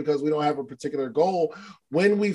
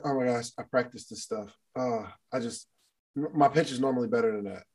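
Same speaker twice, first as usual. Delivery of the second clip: soft, embarrassed, stumbling over words; flustered stammer; 7 semitones lower, 8 dB quieter than usual. Retold or imitated. Young woman stammering after botching her pitch, flustered and soft. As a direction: fumble it like a nervous pitch. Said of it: "She's stuttering and she's like".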